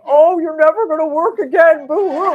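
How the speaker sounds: high, loud, laughing mock of her despair; laughing mockery. Husband mocking her old despair, laughing high and loud. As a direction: tease her with a laughing boo-hoo. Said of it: "laughed hysterically saying".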